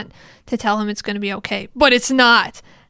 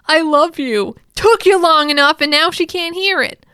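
acted-out outburst, louder and higher; impassioned cry; 6 semitones higher, 7 dB louder than usual. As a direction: cry out a retold confession of love. Dying declaration of love retold, voiced loudly.

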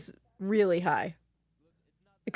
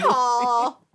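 Southern accent; 4 semitones higher, 7 dB louder than usual. Shown as a demonstration. Friend saying the movie title in a Southern accent. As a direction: Call drawn out in a Southern accent, rhymed with paw. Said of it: "It sounds like your paw".

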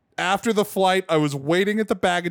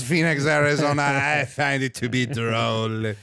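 put-on snob; 5 semitones lower than usual. Same put-on snob bit.

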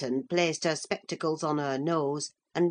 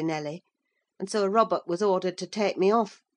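usual speaking voice

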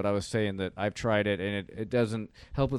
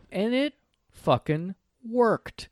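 incredulous; 10 semitones higher, much slower than usual. Real, not acted. Disbelieving punchline.

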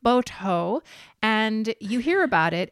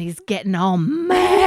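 MAD stretched long and louder, mockingly; mocking drawn-out MAD; 6 dB louder, slower than usual. Co-host mocking an angry boyfriend, MAD drawn out. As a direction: draw out "mad" into a teasing bleat.

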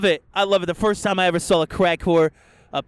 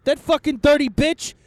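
high, loud, fast indignant outburst; fired-up rant; 10 semitones higher, 4 dB louder than usual. Real, not acted. Host worked up about a GoFundMe scammer, loud.

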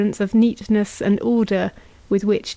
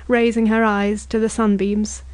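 ordinary delivery

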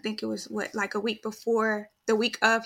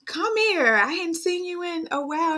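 grandma's welcome; 7 semitones higher than usual. Retold or imitated. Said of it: "you know, kind of like".

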